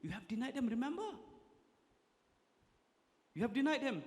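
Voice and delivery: high taunting accuser, a devil whispering doubt; devil's accusing voice. Acted. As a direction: sneer like the devil taunting Peter. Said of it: "the devil might come and say".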